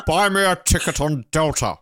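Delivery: hammed-up Scottish film-star voice; put-on star impression, punched out; Connery. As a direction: play a thrifty movie star with a put-on burr. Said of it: "Connery just decided to slash the budget himself".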